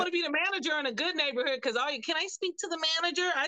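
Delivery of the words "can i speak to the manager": entitled customer. Acted. Imitating complaining customer.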